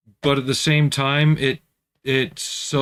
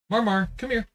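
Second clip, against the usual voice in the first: cat-calling voice; 11 semitones higher than usual. Real, not acted.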